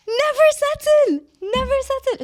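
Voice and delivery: very high loud never settle; shrieked slogan. Real, not acted. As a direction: scream it as a battle cry. Shrieked emphasis.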